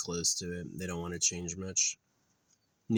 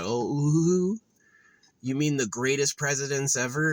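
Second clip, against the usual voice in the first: higher, louder mock-impressed coo; mocking fawning; 6 semitones higher, 4 dB louder than usual. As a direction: ooh it up in sarcastic awe. Mocking those defending the presidents.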